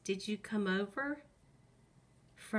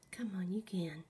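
ordinary talk

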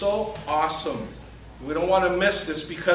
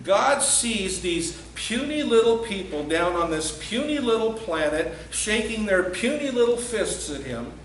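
ordinary delivery

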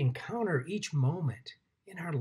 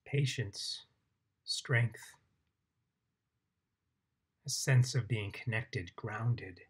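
guided meditation. Acted. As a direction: guide them gently into the ground, unhurried.

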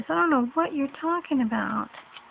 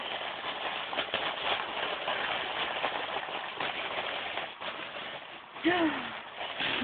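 drawn-out breathy gasp of delight; long hushed intake of breath; long amazed gasp; 2 semitones higher, 8 dB quieter than usual. Real, not acted. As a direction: gasp in as the wrapping comes off. Long gasp at wrapped gifts.

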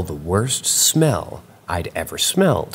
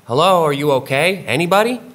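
loud high call, rising at the end; yelling back; 10 semitones higher, 6 dB louder than usual. A narrator's reading. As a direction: yell it out across the trees. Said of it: "My wife yells back".

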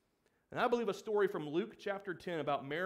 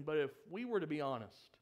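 usual speaking voice

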